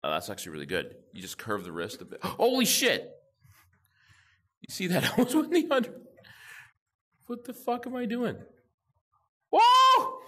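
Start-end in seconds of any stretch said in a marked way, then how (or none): 2.2-8.4 gasp, then high loud laughing joy; excited, laughing. Real, not acted. Gamer cheering a near hundred to viewers, laughing and thrilled. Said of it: "I'm getting too excited now". 9.5-10.2 very high, loud whoa; excited whoa. Real, not acted. Gamer shouting at his Skee-Ball shot, amazed. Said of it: "I'm getting too excited now".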